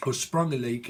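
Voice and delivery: ordinary talk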